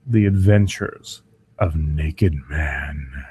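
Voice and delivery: slow, epic trailer announcer read; slow dramatic movie trailer narration. Acted. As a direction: intone it like a blockbuster trailer. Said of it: "Now my best, but it'll do".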